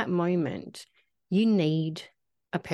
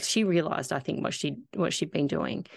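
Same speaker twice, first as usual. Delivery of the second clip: ordinary talk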